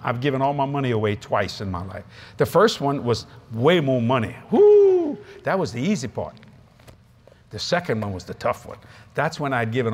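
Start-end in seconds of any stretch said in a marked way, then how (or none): 4.5-5.2 high, loud whoop. Real, not acted. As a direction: let out a big "woo". Whoops over giving more money away.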